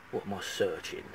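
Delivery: quiet, indignant mutter; muttered to himself. Real, not acted. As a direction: grumble it under your breath. Muttering over the rude question.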